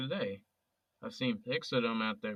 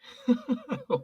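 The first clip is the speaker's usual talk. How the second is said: high, sudden cracking laugh; burst of laughter; 6 dB louder than usual. Acted. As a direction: burst out laughing at the absurd story. Laughs at lizard friends story.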